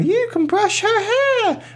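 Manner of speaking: put-on high slow girly voice; very high sweet girl in an ad; little girl voice. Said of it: "girls going".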